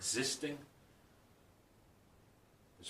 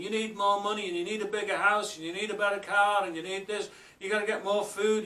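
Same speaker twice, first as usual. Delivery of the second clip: louder, higher grasping voice; bogeyman voice; 7 semitones higher, 6 dB louder than usual. Acted. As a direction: push it like greed talking.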